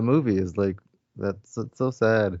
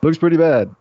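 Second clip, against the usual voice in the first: quoted movie line, higher and louder; imitating Panaka; 6 semitones higher, 7 dB louder than usual. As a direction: deliver the movie line raised and punchy. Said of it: "Panaka's like".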